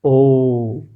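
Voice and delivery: long held ओ vowel sound; drawn-out vowel. Shown as a demonstration. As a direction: stretch the vowel ओ out slowly. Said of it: "vowel demo". (लंबा खींचा हुआ ओ)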